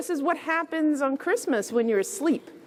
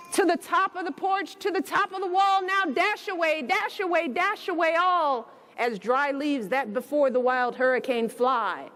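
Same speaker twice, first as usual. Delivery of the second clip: higher, lilting read-aloud; lively storybook reading; 6 semitones higher than usual. A narrator's reading. Reading the reindeer verse to children, higher and lively. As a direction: let the rhythm of the verse carry.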